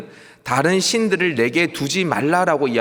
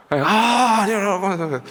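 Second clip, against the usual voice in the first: high, loud startled no; hammed-up embarrassed protest; flustered denial; 6 semitones higher, 3 dB louder, much slower than usual. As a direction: blurt out the denial, caught off guard. Trainee caught out, denying it.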